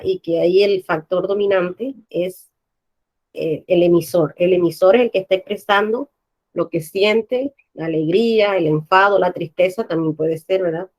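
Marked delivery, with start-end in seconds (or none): none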